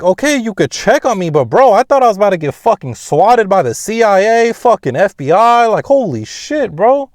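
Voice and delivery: high, loud, incredulous rant; acted outraged protest. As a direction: re-enact arguing back in shocked outrage. Acted argument back at his dad.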